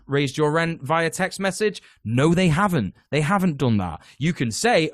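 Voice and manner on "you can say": ordinary talk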